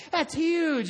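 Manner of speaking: high, loud imitation of an impressed onlooker; mock crowd amazement. Pier crowd siding with the boy, exaggerated amazement. Crowd cheering the boy's fish.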